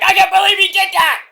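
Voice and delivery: high, whining commentator voice; whiny Vic Joseph. Retold or imitated. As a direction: whine about the lost candy. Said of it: "Vic Joseph's like".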